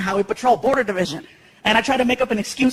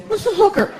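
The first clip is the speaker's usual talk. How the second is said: put-on higher innocent kid; 10 semitones higher than usual. Son asking dad a question, higher kid voice. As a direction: ask like a curious little boy.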